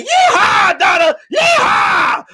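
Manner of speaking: loud, high rodeo holler; mock cowboy whoop. Riding on Donna's back, whooping in mock triumph.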